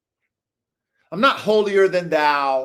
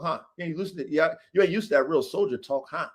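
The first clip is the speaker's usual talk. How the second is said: ordinary talk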